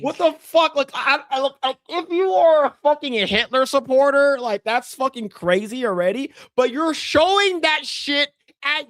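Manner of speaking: high-pitched incredulous rant; retold outraged tirade; appalled scolding. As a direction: berate him in disbelief, pitch climbing. Retelling his appalled reaction to the march.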